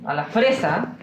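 ordinary delivery

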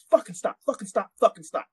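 high-pitched imitated sergeant command; high, repeated mock order; urgent sergeant's order. Retold sergeant ordering him to halt.

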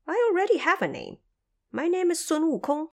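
high, lively trapped monkey king, grateful; eager Monkey King. A narrator's reading. Trapped Monkey King thanks the visiting Bodhisattva, high and eager.

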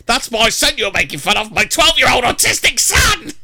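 outraged mother. Acted. Reading the mother's quote aloud, confronting the mocking staff in outrage. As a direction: play the mother rising to a furious, shrill-pitched protest. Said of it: "I turned around and said to him".

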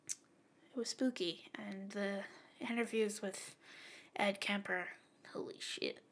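low, very soft near-whisper; spooked hush. Real, not acted. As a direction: trail into an awed whisper. Recalling a spooky, disturbing show.

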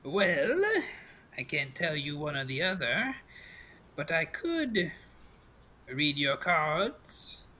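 slow, teasing crone with drawn-out endings; coy old crone. Acted. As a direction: dangle the offer like a sly fortune teller. GM plays the crone fortune teller.

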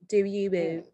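put-on light copy of a Baltimore accent; mimicking a Baltimore accent; imitating Baltimore accent. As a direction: echo the Baltimore twang, half-joking. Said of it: "That was Desiree's accent".

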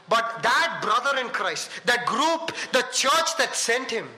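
high, emphatic sermon delivery; impassioned preaching. Real, not acted. Preaches at full force.

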